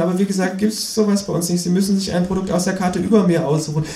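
put-on high-pitched teenage boy voice; high teen counter-clerk voice; sixteen-year-old cashier. A narrator's reading. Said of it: "sagt der Junge".

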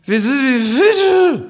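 put-on drunken kangaroo voice. Drunk kangaroo musing at the bar, slow high slur. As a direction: drag out each word like a drunk philosopher.

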